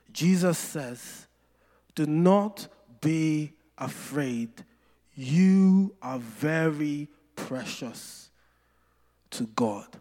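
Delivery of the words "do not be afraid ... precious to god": solemn reassurance. Retold or imitated. Preacher gives Jesus's words to the fearful, slowly and solemnly. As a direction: let each phrase rest before the next.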